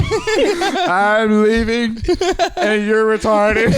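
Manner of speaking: high, very slow hammed-up wail; imitating a weepy farewell insult; mock crying exit. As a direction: stretch each word into a fake tearful whine. Mock farewell echoing the earlier outburst.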